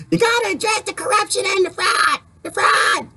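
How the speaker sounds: high-pitched shouted catchphrase; Debbie impression. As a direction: yell it high, imitating a woman on a crusade. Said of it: "Debbie always says".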